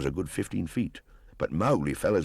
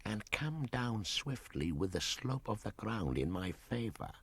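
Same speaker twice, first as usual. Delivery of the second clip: snake's whisper; 6 semitones higher, 9 dB quieter than usual. Acted. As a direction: hush it like a plotting python. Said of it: "Kaa whispered".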